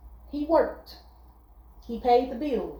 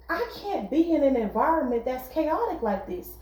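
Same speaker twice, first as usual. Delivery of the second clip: ordinary talk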